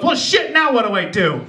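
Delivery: high, befuddled mugger; flustered mugger. Retold or imitated. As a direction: play the mugger stumped. Mugger's dilemma.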